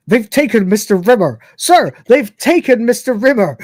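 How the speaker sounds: loud, high panicked shout; retold panicked shout. Android shouting to the captain in alarm, loud and high. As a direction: yell it in alarm, pitch climbing. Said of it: "Crichton shouts".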